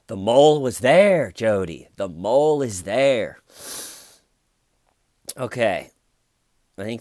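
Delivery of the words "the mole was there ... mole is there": loud, pressing detective; mock interrogator hammering it in; emphatic insistence. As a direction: drive the point home loudly. Mimics detective pressing suspect.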